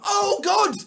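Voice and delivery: high, loud, whiny parody of a counter-poster; mock outrage. Mocking posters who point at other attacks, high whiny parody. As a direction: sneer it out like a self-righteous know-it-all. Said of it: "people going".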